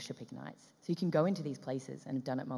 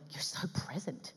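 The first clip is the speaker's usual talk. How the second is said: slow, soft, breath-only praise; awed whisper; 2 semitones higher, 6 dB quieter, slower than usual. Retold or imitated. Director marvelling at actress's presence, slow whisper. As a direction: whisper it in wonder.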